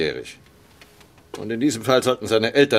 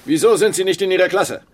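sharp, higher and louder rebuke; stern reprimand; 8 semitones higher, 5 dB louder than usual. Acted. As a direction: catch the cadet out with authority. Commander catches cadet talking. (scharfer, lauter Tadel)

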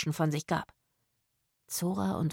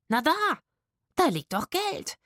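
high-pitched, louder insistence; excited exclamation; 10 semitones higher, 4 dB louder than usual. A narrator's reading. Woman spotting money on the ground, excited exclamation. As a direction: point it out, voice shooting up.

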